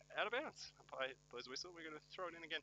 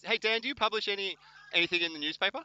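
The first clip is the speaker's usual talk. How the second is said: higher, louder shout-over to a mate; calling out; 10 semitones higher, 7 dB louder than usual. Real, not acted. Commentator heckles Dan about newspaper publishing, calling out. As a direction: call across with a pointed question.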